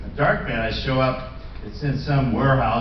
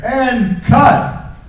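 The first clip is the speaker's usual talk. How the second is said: calling cut; 8 semitones higher, 5 dB louder than usual. Retold or imitated. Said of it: "you'd hear".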